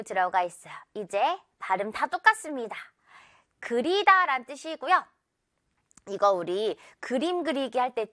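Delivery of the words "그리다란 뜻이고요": ordinary talk, nothing marked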